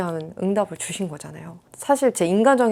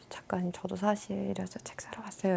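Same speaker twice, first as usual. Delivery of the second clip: soft whisper, much quieter; whispered confession; 11 dB quieter than usual. Retold or imitated. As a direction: lean in and whisper a shy secret. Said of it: "되게 쬐끄맣게 말하더라고요".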